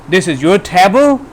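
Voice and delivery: "table" goes high at the end; rising question on "table". Shown as a demonstration. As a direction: turn the statement into a question by lifting "table". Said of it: "The table goes high".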